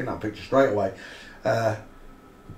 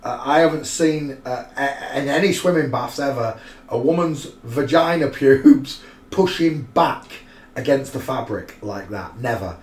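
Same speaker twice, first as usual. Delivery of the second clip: ordinary talk, nothing marked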